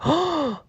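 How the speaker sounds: high, sharp reenacted gasp; shocked gasp. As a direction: gasp in mock horror. Reacting to cookies on the floor.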